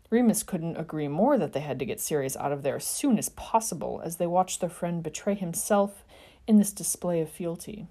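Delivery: ordinary delivery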